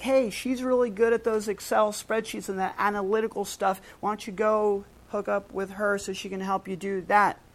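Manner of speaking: lightly voiced chatty coworker; a hint of a chatty coworker passing tips; office gossip. Coworker recommending a colleague, light chatty voice. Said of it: "then you're like".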